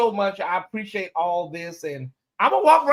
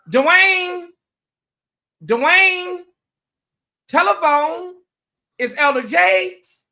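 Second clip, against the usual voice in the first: mama hollering through the house, higher and louder; mama calling out; 13 semitones higher, 7 dB louder, much slower than usual. Retold or imitated. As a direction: call him to the phone like his mama, drawing out the last line slowly. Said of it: "what he said his mama said".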